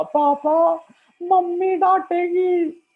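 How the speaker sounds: put-on high whiny child's wail; crying little girl. Girl telling her papa, high tearful whine. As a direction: whimper it like a sulking little girl. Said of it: "बच्ची ने कहा".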